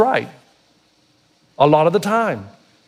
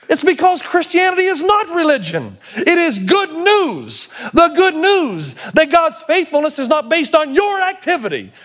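higher, driving sermon voice; fervent preaching; 8 semitones higher than usual. Acted. Pastor to the congregation, proclaiming good news fervently. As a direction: preach it out with conviction.

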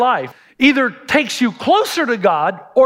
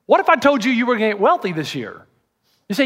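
ordinary delivery

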